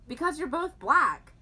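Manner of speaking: blunt classmate. Retold or imitated. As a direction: say it matter-of-fact, loud. Classmate's blunt reply quoted.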